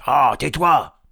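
loud, high order; angry trolls. Acted. Trolls silencing Tom, loud irritation. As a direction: cut the complainer off loudly. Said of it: "trois trolls en colère".

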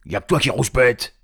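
loud, high accusation; angry troll. Acted. Tom blaming Léon, loud squabbling. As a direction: squabble back loudly in troll voice. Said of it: "trois trolls en colère".

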